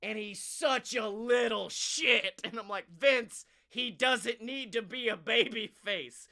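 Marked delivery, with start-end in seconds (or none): 2.9-6.3 loud, raised-pitch mock appeal to the boss; emphatic, fed-up urging; exasperated plea. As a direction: plead loudly, easing off towards the end.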